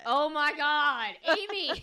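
very loud, high-pitched shriek of disbelief; real shock, calling out her friend; scandalized exclamation. Real, not acted. Cohost hearing of the prank call, scandalized shout.

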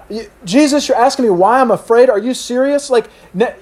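high-pitched, sputtering disbelief; incredulous snap back. Acted. Frightened fishermen questioning Jesus, incredulous protest. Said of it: "snap back".

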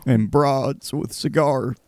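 slow, stretched-out Clinton voice; drawn-out folksy Southern president; imitating Bill Clinton; Bill Clinton impression. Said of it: "Owen Wilson and Bill Clinton".

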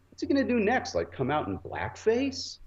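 high mocking jab, slowing down; a sneering quip to a friend; high sarcastic aside; sarcastic joke.

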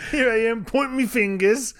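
high-pitched mock Cockney; Cockney accent. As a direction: ham up a cheeky Cockney rocker. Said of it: "answer this in a Cockney accent".

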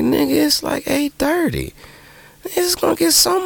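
way up high in disbelief; retold squeaky disbelief; incredulous retort. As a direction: squeal it out like you can't believe him. Retelling his reply, incredulous.

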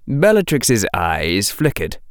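ordinary delivery, nothing marked

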